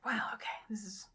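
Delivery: hushed, breathless wonder; awed whisper. Retold or imitated. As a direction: whisper it to yourself. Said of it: "she's like".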